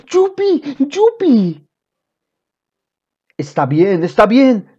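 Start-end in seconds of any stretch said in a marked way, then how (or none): 0.1-1.7 high-pitched cry of joy; joyful shout. A narrator's reading. Boy on the chair shouting for joy, high yelp. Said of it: "gritando dichoso". 4.0-4.8 higher and louder, pressing; firmer insistence. A narrator's reading. Father telling shouting son to calm down, firmer.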